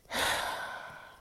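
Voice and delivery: long audible sigh. Real, not acted. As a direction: let out a heavy exhale. Sighs after ending the rant.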